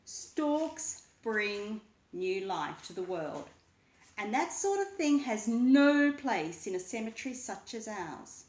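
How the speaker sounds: rising outrage at new life; building indignant skeleton. A narrator's reading. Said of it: "he said".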